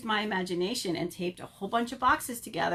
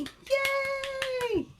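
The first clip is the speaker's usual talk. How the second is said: excited cheer. Real, not acted. Cheering kids after song.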